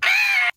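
high delighted scream; excited shriek. Real, not acted. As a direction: squeal with joy. Excited scream over a package.

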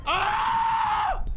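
sudden high yelp; startled yell. Real, not acted. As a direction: shriek in alarm. Startled scream in fight.

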